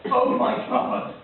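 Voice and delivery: slow, loud, drawn-out gasp of shock; mock horror. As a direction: stretch it out, loudly aghast. Acted horror at dead dolphin.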